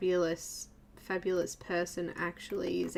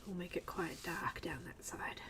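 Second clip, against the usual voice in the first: whispering; 3 semitones lower, 12 dB quieter than usual. Real, not acted. Whispered painting commentary.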